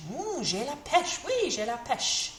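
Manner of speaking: bright, bouncy "J'ai la pêche"; cheerful and perky. Acted. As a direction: sound delighted with life. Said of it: "We're feeling fantastic".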